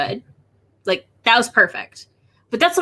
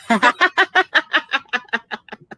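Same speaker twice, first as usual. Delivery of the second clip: a burst of real laughter; 3 semitones higher than usual. Real, not acted. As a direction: laugh openly at the slip. Laughing at secret project.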